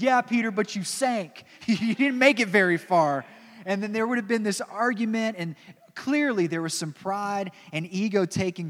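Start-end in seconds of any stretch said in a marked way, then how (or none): 0.0-3.2 loud, higher teasing jab; mocking heckle with a laugh; cutting in. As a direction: cut in to take the braggart down a peg. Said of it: "probably cut Peter off and said".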